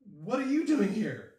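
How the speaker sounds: high, loud, startled demand; alarmed Baroness. Acted. Baroness confronting her cousin unexpectedly, high alarmed outburst. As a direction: act out an astonished noblewoman's challenge.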